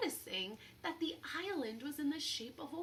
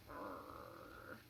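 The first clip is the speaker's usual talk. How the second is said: deep, rough grunting growl; captain's grumbling urgh; low growl; 9 dB quieter than usual. Acted. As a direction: growl like an annoyed pirate. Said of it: "Captain Bones growled".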